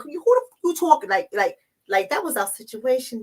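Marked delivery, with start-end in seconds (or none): 0.0-1.1 high, sharp imitation of an angry partner; mock angry threat. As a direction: bark it at the man across the table. Partner wants to grab somebody.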